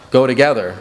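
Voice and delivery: ordinary talk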